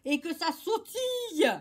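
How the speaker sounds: drawn-out, loud prodding; loud urging. Acted. King hurries the toad onto the board, loud urging. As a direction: chivvy the dawdler along loudly.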